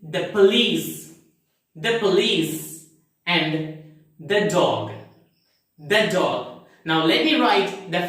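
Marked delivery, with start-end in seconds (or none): 0.0-2.9 short thuh in "the police"; "the" ends in a short vowel, not ee; weak the before consonant. Shown as a demonstration. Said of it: "let's see the examples". 4.2-6.7 short thuh in "the dog"; "the" without a long ee before "dog"; weak the before consonant. Shown as a demonstration. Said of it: "let's see the examples".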